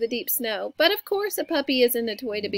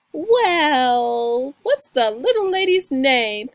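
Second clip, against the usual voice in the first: loud, drawn-out hearty question; police officer; 9 dB louder, much slower than usual. A narrator's reading. Officer registering the puppy, loud drawling voice. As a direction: boom it out slowly as an officer.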